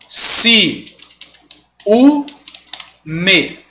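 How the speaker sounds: Si, u, me said as three separate syllables; syllables split apart. Shown as a demonstration. Teacher separates ciúme into syllables for students. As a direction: break ciúme into Si, u, me. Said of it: "como é que eu vou separar?".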